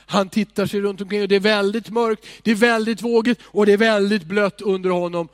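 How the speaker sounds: building dramatic narration. A narrator's reading. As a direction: paint the storm with rising force.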